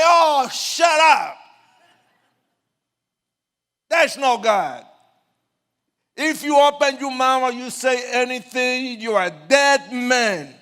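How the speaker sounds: loud, high furious yell; angry shout. Retold or imitated. Gang leader yelling threats at the preacher, furious shout. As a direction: shout him down hard. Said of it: "I cursed him and I humiliated him".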